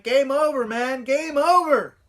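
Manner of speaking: high, loud, desperate wail; panicked yell. Retold or imitated. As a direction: scream it like a terrified kid at the movie. Terrified kid panics at movie.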